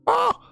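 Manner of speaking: sudden high yelp of surprise; startled yelp. Real, not acted. Surprised at the four-eyed creature.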